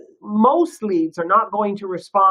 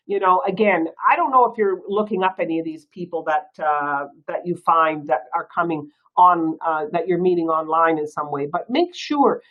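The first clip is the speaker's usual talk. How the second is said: normal talking voice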